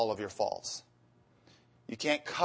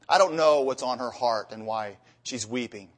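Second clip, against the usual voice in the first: ordinary talk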